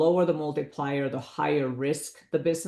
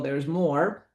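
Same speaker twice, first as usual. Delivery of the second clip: lightly hyped late-night pitchman; midnight infomercial voice. Retold or imitated. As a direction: tease it like a TV sales pitch. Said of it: "gonna sound like the midnight infomercial".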